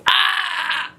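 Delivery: high yelp of disgust; cringing yelp. Real, not acted. Reader recoiling from her own imitation, yelping. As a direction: cry out as the cringe hits.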